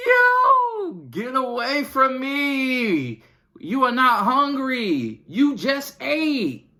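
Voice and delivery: very high, slow, loud mock yell at the kids; fed-up parent yelling. Parent snapping at kids who just ate, very high, slow mock yelling. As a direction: drag it out in a shrill exaggerated scold. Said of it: "wanna curse these kids out".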